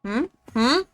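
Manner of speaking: talking with a mouth full of food; muffled through a mouthful. Real, not acted. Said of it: "My mouth is full".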